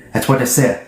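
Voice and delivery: ordinary delivery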